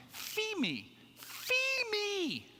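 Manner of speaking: put-on high, wailing little-kid cry of fee me, mispronouncing free as fee; toddler screaming. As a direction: scream fee me like a panicked small child stuck in the snow. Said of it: "he starts screaming".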